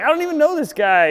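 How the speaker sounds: high, loud imitated denial; imitating Brendan. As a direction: act out a huffy denial. Brendan denying friendship.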